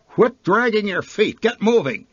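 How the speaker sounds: put-on high, sharp bark at a dawdling kid; barked order. A narrator's reading. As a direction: snap at the little one to hurry. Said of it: "she barked".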